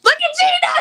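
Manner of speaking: high excited cheering. Real, not acted. Hyping up a friend.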